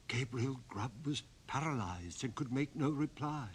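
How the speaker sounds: ordinary delivery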